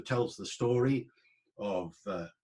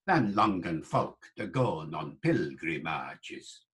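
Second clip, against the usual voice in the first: Chaucer's accent. Shown as a demonstration. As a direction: Middle English verse, sounded final E's. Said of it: "Chaucer's accent".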